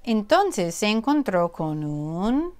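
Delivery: ordinary delivery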